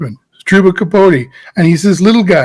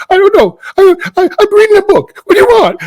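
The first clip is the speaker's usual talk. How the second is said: much higher, frantic hammed-up panic; frenetic overacting; 13 semitones higher, faster than usual. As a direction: overact a flustered prisoner, all nerves. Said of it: "the worst frenetic energy acting".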